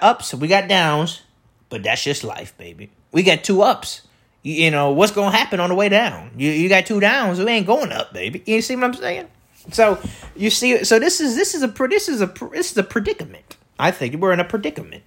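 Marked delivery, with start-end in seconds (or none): none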